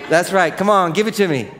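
ordinary talk

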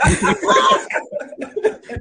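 breathless high giggle; squealing laugh out of control. Real, not acted. Cast cracking up in improv.

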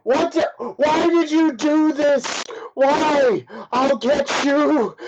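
high-pitched, strained mock yell; yelling in character. Bound captive raging at his captors, high-pitched mock yelling. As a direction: cry out high, dragging out the last threat. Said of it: "he's gonna yell".

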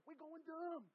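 high-pitched, hammed-up slang boast; imitated hyphy cousins. Imitating younger cousins' slang, exaggerated repeat.

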